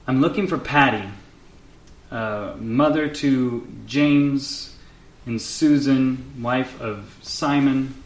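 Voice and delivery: loud, slow, deliberate invocation; drawn-out séance calling; summoning the dead. Acted. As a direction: call out to a spirit, spacing each name. Member calls spirit over bones.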